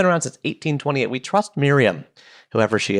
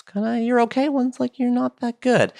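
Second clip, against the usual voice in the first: lightly voiced snippy reviewer, higher; mocking reviewer voice; 10 semitones higher than usual. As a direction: mimic a lukewarm critic. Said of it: "one's really bad".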